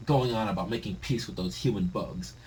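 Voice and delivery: usual speaking voice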